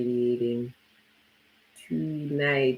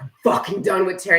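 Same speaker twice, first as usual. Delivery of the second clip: louder, higher, fast mock exasperation; fed-up outburst; 7 semitones higher, 6 dB louder, faster than usual. Imitating herself in a bad mood, hammed-up exasperation. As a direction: throw up your hands, done with it all. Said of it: "I'd be in the ethers being like".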